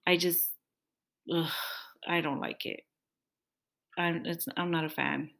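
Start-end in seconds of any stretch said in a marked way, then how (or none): none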